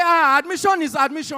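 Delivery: high, loud insistent mother's voice; mother brushing off the objection, delighted; a mother ignoring her son, gleefully; excited mother. Retold or imitated.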